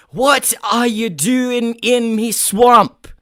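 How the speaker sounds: big, loud, high Scottish ogre holler; outraged mock-Scottish roar; Shrek. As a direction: bellow it slowly in a Scottish brogue. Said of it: "like, imitate Shrek".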